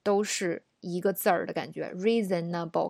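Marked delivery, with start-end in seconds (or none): 1.9-2.9 reasonable in four evenly weighted syllables, ree-zen-na-bo; REE-ZEN-NA-BO, every syllable full; like a four-character idiom. Shown as a demonstration. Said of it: "感觉是四字成语的感觉".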